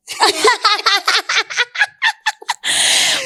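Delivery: loud high burst of laughter; real delighted laugh; big laugh. Real, not acted. Laughs at own joke.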